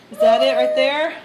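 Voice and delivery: usual speaking voice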